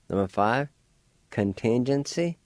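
ordinary delivery

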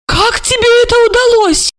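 high, loud astonished question. A narrator's reading. Olga asks Marina in astonishment, high and loud. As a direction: ask in high disbelief. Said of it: "изумилась Ольга".

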